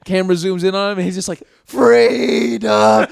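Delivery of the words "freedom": high, loud cry of freedom, imitating the movie hero; yelled battle cry. Retelling the movie's ending to friends, a loud imitated yell. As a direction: belt it out like a dying rebel's last cry. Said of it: "He yells "freedom" at the top of his lungs".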